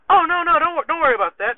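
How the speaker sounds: high-pitched, dismissive protest; hasty brush-off. Retold or imitated. Soldier deflecting his sergeant's question about the car, evasively. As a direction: wave the question away before it lands.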